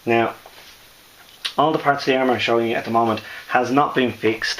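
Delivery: ordinary talk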